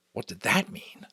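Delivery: usual speaking voice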